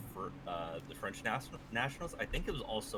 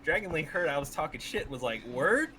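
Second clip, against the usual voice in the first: hyped retelling; 7 semitones higher, 9 dB louder than usual. Real, not acted. Excited retelling, louder.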